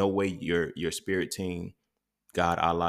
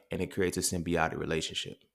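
ordinary talk

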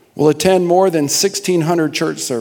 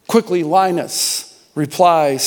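ordinary talk, nothing marked

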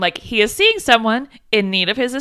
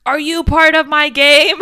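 loud, higher retold yell; yelling after her; 5 semitones higher, 8 dB louder than usual. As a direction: call it out slowly after someone leaving. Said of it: "he's, like, yelling after her".

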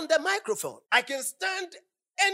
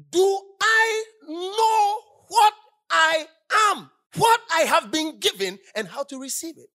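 preaching cry; 7 semitones higher, 8 dB louder than usual. Real, not acted. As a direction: cry it out to the congregation. Preaching, voice crying out.

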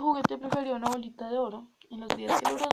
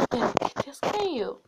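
indignant; 4 semitones higher, 7 dB louder than usual. Acted. Princess snaps at the frog.

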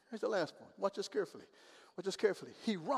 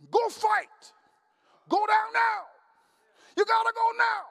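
loud urgent battle cry; shouting command; 10 semitones higher, 7 dB louder than usual. Acted. Preacher voicing battle command.

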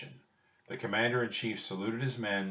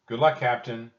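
loud, hearty call after someone; raised-voice send-off; 3 semitones higher, 10 dB louder than usual. A narrator's reading. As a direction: call it out to a departing captain. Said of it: "Jack called".

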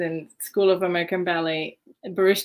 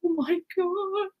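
awed gasp; 11 semitones higher, 4 dB quieter, slower than usual. Retold or imitated. Sweeter girls staring in awe.

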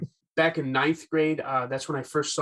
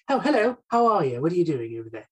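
British guy; 4 semitones higher, faster than usual. Shown as a demonstration. Demoing his British guy accent for the host. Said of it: "just like a British guy".

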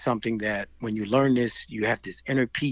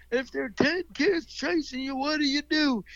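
sing-song Southern instructor voice, pitched up; Southern accent; 12 semitones higher than usual. Retold or imitated. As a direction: imitate a Southern karate teacher calling to kids. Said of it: "'Cause he had a Southern accent".

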